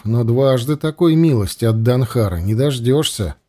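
ordinary delivery, nothing marked

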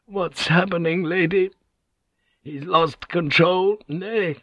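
high, distressed pleading; tearful. A narrator's reading. Secretary tearfully asking the lady, distressed. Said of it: "Tears started running down the secretary's cheeks".

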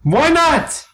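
angry actor insisting he is furious, not angry. Acted. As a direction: bellow the denial. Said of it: "I'm fucking furious".

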